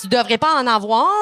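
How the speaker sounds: ordinary talk, nothing marked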